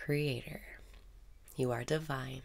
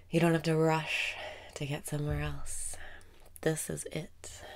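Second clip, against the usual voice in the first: calm meditation voice drifting into whispers; guided meditation. Acted. Guiding a listener through breaths and affirmations, soothing meditation voice. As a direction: lead the listener's breathing gently, in and out.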